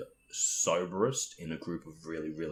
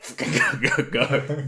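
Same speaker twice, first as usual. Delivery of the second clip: louder, higher, laughing hurry-up; urgent mock hurry; 8 semitones higher, 10 dB louder, slower than usual. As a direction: rush them out the door, giggling. Hurrying friends past the guard.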